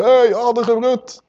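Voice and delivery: "Hey, addergebroed" pitched high and drawn out slowly; mock street shout. Shown as a demonstration. Preacher shows what shouting it on the street would sound like, mock call. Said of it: "Dat hoeft hij niet op de straat te schreeuwen".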